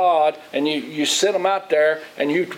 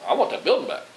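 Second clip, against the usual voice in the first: whiny complaint; 8 semitones higher, faster than usual. Retold or imitated. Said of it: "or would you just like".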